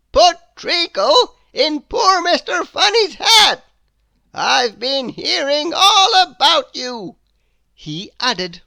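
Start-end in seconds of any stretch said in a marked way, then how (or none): 0.1-7.2 loom over the culprit as a stern wizard. A narrator's reading. Wizard scolding Mister Mischief, stern and loud. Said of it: "he went on".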